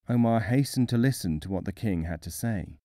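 ordinary delivery

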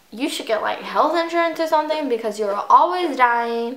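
usual speaking voice

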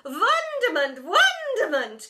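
high, loud, slow sing-song call; calling teacher. A narrator's reading. Teacher answering the groaning kids, high and loud. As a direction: trill it out grandly. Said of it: "called the teacher".

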